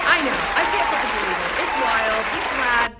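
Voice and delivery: loud, thrilled; practically shouting with excitement; excited. Real, not acted. Host gushing about her guest to viewers, loud excited.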